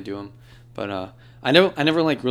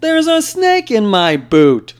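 much higher, louder, slow cowboy drawl; Woody impression; 11 semitones higher, 12 dB louder, much slower than usual. Doing Woody's catchphrase for friends, much higher slow cowboy voice. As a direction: stretch the words out slowly in a high cowboy voice. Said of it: "He says".

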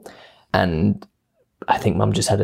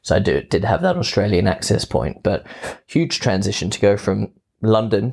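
ordinary talk

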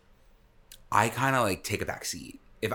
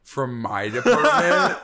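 offended; 4 semitones higher, 7 dB louder, slower than usual. Acted. Mock offense in skit.

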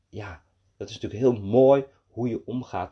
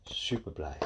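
ordinary delivery, nothing marked

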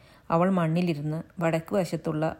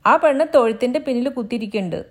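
fast, high and loud; eager maid, raised and fast; quick servant tattling; 7 semitones higher, 9 dB louder, faster than usual. Acted. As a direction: rattle it off to the husband. Maid tells where she hides.

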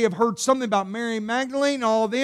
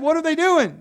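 usual speaking voice

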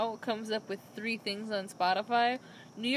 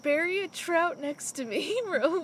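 high-pitched, hammed-up pleading; pretend dying wish; 7 semitones higher than usual. Co-host imagining burial beside a beloved old trout, melodramatic. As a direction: act out a melodramatic last request, voice raised. Plays out outliving a pet trout.

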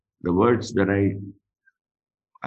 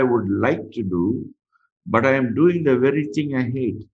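scripture reading; 4 semitones higher than usual. Acted. Preacher reading Romans to the church, raised and fervent. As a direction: read Paul's words out to the congregation with fervor.

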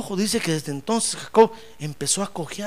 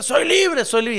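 high, toned-down mock yell; lightly imitated cry, pitched up; retold cry of freedom; 6 semitones higher, 5 dB louder, slower than usual. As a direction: echo the cry lightly, without full volume. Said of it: "empezó a gritar".

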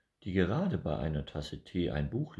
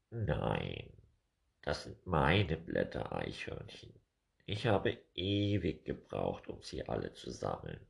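a grumpy bird defending his blanket; gruff woodpecker; 2 semitones lower than usual. Acted. Woodpecker answers.

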